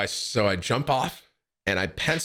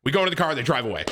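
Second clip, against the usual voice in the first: usual speaking voice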